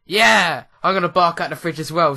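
loud, fast gleeful shout; gleeful loud yell, joining in the fun; excited shout. Real, not acted. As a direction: cheer the dog on loudly. Joining dog barking at fridge.

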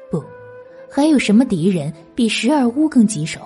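ordinary delivery

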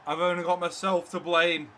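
loud, projected football commentary; live play-by-play. Real, not acted. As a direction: call the match as it happens, peaking at the goal. Live match commentary over gameplay.

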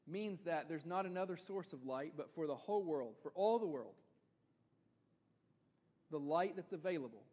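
usual speaking voice

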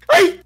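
sudden high shriek of fear; startled scream; scream of fright. Real, not acted. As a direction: yelp like something grabbed you. Sudden jump scare.

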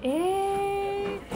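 long high drawn-out eeh; surprised eh. Real, not acted. Reacting to a viewer's joke comment, surprised. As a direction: pitch up in real surprise.

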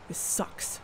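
breathy, under-the-breath grumble; muttered disappointment. Acted. Robin to Stevie after the interview fails, muttered. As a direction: grumble it to yourself.

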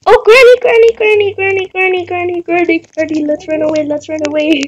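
panicked repeating as you back away. Real, not acted. Player spots Granny and flees, panicked.

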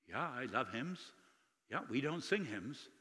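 soft, understated quoted reply; subdued old lady. Retold or imitated. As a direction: lightly voice her quiet admission. Retold old lady's subdued answers.

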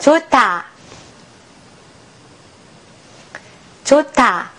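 "チョタ" as one linked word with aspirated t; "チョタ" said whole, breathy release on the second consonant; model aspirated pronunciation. Shown as a demonstration. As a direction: model "チョタ" for learners to repeat, puff on タ. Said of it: "発音してみましょ".

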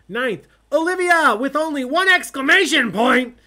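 very loud, high shouted announcement; exclamatory announcing. Real, not acted. Host announcing a team name to players, exclamatory yell. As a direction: shout it like it ends in exclamation marks.

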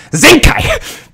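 high, loud disbelieving cry; can't believe what you see; exasperated gamer shout; outraged surprise. Real, not acted. Shocked at the enemy team.

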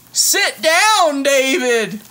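high, loud scolding cry; teacher's shout. Acted. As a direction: yell it like a fed-up teacher. Teacher scolding in read book.

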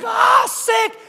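very high, loud squeal of delight; excited shriek. Retold or imitated. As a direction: squeal like a thrilled teenager. Rhoda reacting at the door.

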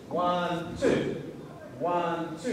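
ONE drawn out, then TWO as a separate beat; two syllable count. Shown as a demonstration. Teacher showing students a split technique, ONE and TWO dragged apart. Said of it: "It's not just a one syllable technique".

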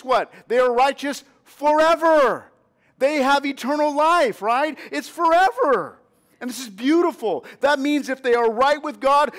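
building, insistent pulpit delivery; preaching. Acted. Pastor rousing young believers about eternal life, fervent preaching. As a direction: drive home "forever" to the church.

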